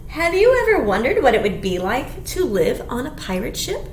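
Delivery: usual speaking voice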